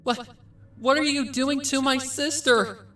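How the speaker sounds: high, loud, panicked filly cry; shrill child pleading in horror; frightened cry. Acted. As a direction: scream it out in terror for her sister. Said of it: "The young Pinkamena cried from behind the bars".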